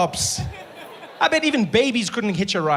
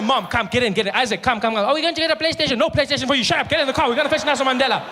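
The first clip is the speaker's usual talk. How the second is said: high, hurried rounding up of family; rushing the family to see Mandela, high and excited; excited calling; 6 semitones higher, faster than usual. Retold or imitated. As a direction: yell the family into the car.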